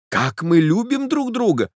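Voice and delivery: a lover's ecstatic cry; cried out. A narrator's reading. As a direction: burst out with joy, pitch soaring. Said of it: "закричал я".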